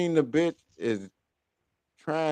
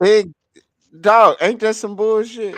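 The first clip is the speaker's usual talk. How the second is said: much higher and louder, incredulous outburst; outraged exclamation; 9 semitones higher, 8 dB louder than usual. Real, not acted. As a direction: blurt it out in disbelief. Outraged reaction to the story.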